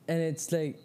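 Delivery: ordinary delivery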